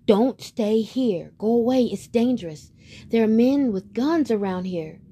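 geese warning. Acted. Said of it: "they warned him".

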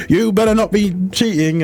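high-pitched teasing scolding; mock telling-off. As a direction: imitate friends warning a flirt. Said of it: "I often get told off".

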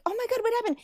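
sudden high alarmed pitch; alarmed mom. Retold or imitated. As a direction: jump up in pitch, worried. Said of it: "and I was like".

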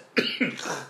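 short cough from water; real cough breaking in; choking cough. Real, not acted. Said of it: "choking on water".

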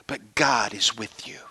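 soft hushed whisper; whispered reassurance to a sick friend; whispered comfort. Retold or imitated. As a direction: breathe it gently as comfort. Pastor comforting sick man.

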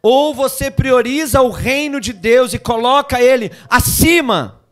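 loud, slow, hammering; full-force sermon; preaching climax. Real, not acted. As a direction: stretch each phrase and build.

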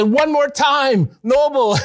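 high, slow, ringing call to a dying man; excited urging. Retold or imitated. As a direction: stretch it out, thrilled and urgent.

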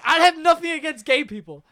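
high, loud mock protest. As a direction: deny the accusation emphatically, imitating the kid. Kid protests the teacher's accusation.

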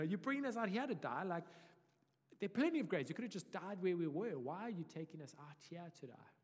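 high, loud complaining whine; grumbling sarcasm. Retold or imitated. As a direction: grumble bitterly at Moses. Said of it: "There's a grumbling spirit".

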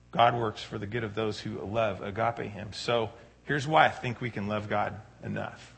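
ordinary delivery

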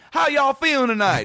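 high, pumped-up crowd greeting; hype host voice. Retold or imitated. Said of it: "That type of a voice".

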